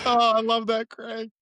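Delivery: high, laughing delight; light up with glee at the joke. Real, not acted.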